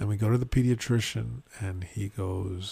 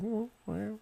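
put-on unbothered toddler; 11 semitones higher, 8 dB quieter than usual. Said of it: "she was just like".

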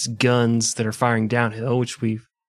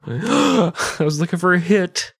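mock gasp; 5 semitones higher, 4 dB louder, slower than usual.

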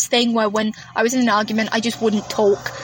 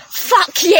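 higher, louder mock yell; shouted insult; 7 semitones higher, 3 dB louder than usual. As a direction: fling it at her. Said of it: "And I just went".

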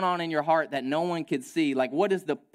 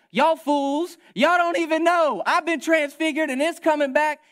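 loud, much higher, taunting crowd; mocking taunt; 12 semitones higher, 7 dB louder than usual. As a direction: hurl it down like a triumphant taunt. Jesus imagined taunting from the cross.

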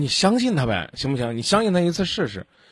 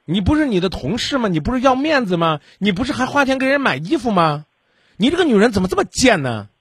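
angry scolding; 6 semitones higher than usual. Real, not acted. Radio host berates the caller for blaming the other woman, angry and raised. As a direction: lay into her, voice raised high.

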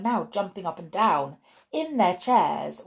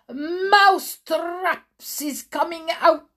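put-on high, slow accented witch shout; raised-voice shout; 11 semitones higher, 7 dB louder than usual. Witch announcing the teachers' response, high loud accented shout. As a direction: stretch it out as a loud proclamation. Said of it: "raised her voice louder than ever".

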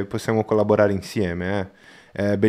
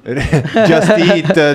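laughing; 6 semitones higher, 6 dB louder than usual. Real, not acted. Laughs over the app name.

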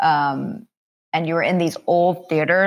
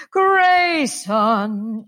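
theatrical Broadway call, loud and high; grand hollywood dame; 6 semitones higher, 4 dB louder than usual. Retold or imitated. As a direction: call the name like a stage diva. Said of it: "very theatrical".

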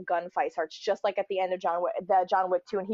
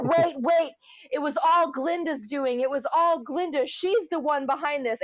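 higher, put-on panicky witch voice; pleading witch; 6 semitones higher than usual. Drowning witch blaming Glinda, mock frantic plea. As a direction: beg and snitch to save yourself. Witch begging for her life.

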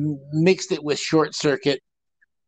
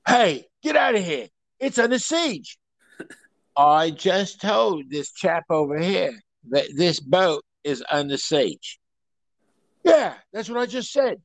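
hammed-up Cockney film star; Cockney accent. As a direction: do a put-out London Cockney. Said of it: "pretty much how Cockneys talk".

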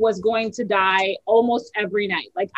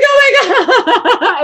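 slow, much higher, louder through laughter; overwhelmed relief, laughing and crying; 12 semitones higher, 10 dB louder, much slower than usual. Retold or imitated. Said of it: "laugh crying".

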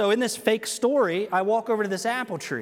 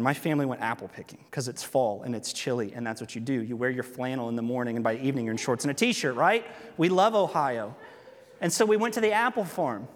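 ordinary talk

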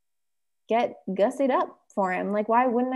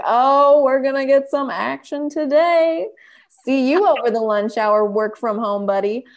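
flirty tease; 3 semitones higher, 6 dB louder than usual. Retold or imitated. Teasing husband about lunch action.

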